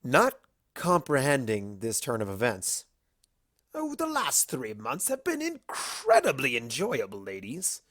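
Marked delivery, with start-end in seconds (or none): 3.7-7.8 grinning Discord. Acted. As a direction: savour the reveal with a grin. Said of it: "Discord said with a grin".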